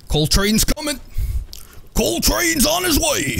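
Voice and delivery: high-pitched hype-man holler, drawing out the last phrase; Coltrane impression. Gamer hyping the team as the next round starts, terrible Coltrane impression. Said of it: "Coltrane impression".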